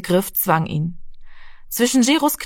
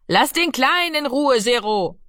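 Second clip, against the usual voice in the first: calling out; 6 semitones higher than usual. A narrator's reading. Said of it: "rief Mami".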